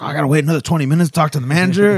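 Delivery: quick, high, loud disbelief; imitating an outraged customer; indignant complaint. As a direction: protest the wait in mock outrage. Retelling the impatient customer's complaint.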